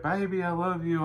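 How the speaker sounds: calling through the toilet. Retold or imitated. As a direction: call it up the pipe to your wife. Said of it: "talking through the toilet tonight".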